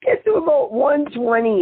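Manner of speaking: normal talking voice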